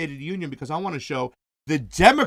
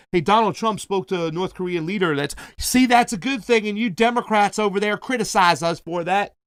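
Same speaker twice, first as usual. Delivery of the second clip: put-on rally-goer voice, pitched up in places; Trump supporter voice; 2 semitones higher than usual. Trump rally-goer defends the North Korea talk, put-on supporter voice. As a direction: play an indignant Trump fan scolding Democrats. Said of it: "I don't know why I did that voice".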